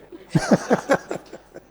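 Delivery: high, breathy laugh; amused high-pitched laughing; burst of laughter. Real, not acted. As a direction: laugh out at the absurd punchline. Laughing at the punchline.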